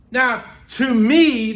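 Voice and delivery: normal talking voice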